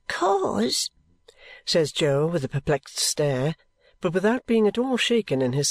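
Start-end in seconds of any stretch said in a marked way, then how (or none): 0.1-0.9 put-on high, thin ragged boy; street urchin voice. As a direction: speak like a timid street boy.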